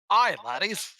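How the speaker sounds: a really bad Scottish dwarf; bad Scottish accent. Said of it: "let's do really bad Scottish accents".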